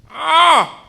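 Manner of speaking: put-on high, loud old man mishearing; hammed-up hard-of-hearing grandfather; deaf grandpa. Deaf grandpa answering his grandson, loud and high.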